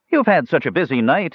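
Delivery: high-pitched, brightened-up exclamation; exclaiming. A narrator's reading. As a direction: gush at the innkeeper in a lifted voice. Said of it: "he exclaimed".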